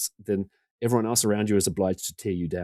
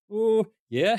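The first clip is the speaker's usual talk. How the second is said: much higher, meek admission; sheepish; 13 semitones higher than usual. Retold or imitated. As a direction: caught out and owning it, squeaky. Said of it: "I was like".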